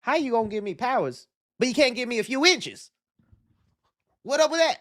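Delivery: hammed-up Kevin Hart complaining about his height; terrible Kevin Hart. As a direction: do a bad Kevin Hart, whiny and high. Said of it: "That was a terrible Kevin Hart".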